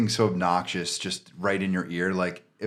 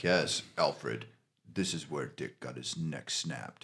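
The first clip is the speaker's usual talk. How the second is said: lower, softer mock Batman, slow; a hushed, grim Batman put-on, taken slowly; 6 semitones lower, 12 dB quieter, much slower than usual. Walking around Dick's body in VR, a lower, softer mock Batman. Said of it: "you're like".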